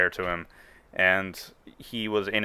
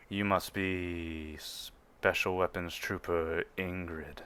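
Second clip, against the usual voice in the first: imposing Imperial officer; 2 semitones lower, 8 dB quieter, much slower than usual. Acted. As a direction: speak down from seven feet tall, unhurried. Officer addresses Ingrid.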